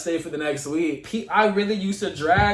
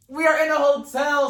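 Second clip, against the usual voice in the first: exasperated shout; 7 semitones higher, 4 dB louder than usual. Retold or imitated. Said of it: "I'm like".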